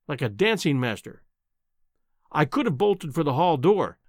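ordinary delivery, nothing marked

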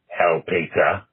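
ordinary talk

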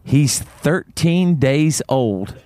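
slow, deliberate retelling of her boast; slow, weighty delivery with pauses; earnest elderly woman. Retold or imitated. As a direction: quote her in dead earnest, drawn out. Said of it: "She looks me in the eye".

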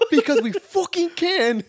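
put-on very high, loud, drawn-out British accent; hammed-up English gangster accent; Peaky Blinders accent. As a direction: repeat the line as a shouting English gangster. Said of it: "that little fucking accent".

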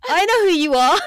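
high, loud, laughing through the words; giddy fan squeal into a laugh; starstruck laughing. Retold or imitated. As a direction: gush at a celebrity, breaking into laughter. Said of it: "I'm looking at her face and I'm like".

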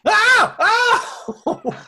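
loud shocked scream with a laugh; startled scream. Acted. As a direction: yelp in alarm. Startled by a surprise attack.

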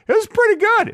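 pretty stretched up high with delight; excited pretty good. Shown as a demonstration. Rating a movie a seven for a friend, gushing. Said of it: "is like a seven or eight".

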